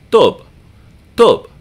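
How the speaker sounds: tub with no puff of air on the T; crisp unaspirated T in "tub". Shown as a demonstration. Teacher showing learners the Arabic tub, unaspirated T. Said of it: "We say tub".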